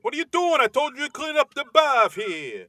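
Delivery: loud, high exaggerated Boston janitor; Boston accent. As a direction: bark it in a thick Boston accent. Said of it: "they've all got the exaggerated Boston accents".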